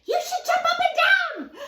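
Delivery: bright jumping shout up high; mock shout. Reading aloud to kids, a playful silly shout. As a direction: shout it like a bouncy suggestion.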